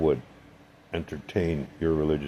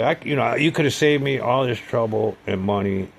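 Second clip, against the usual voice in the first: retold rebuke; 6 semitones higher, 4 dB louder than usual. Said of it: "I said".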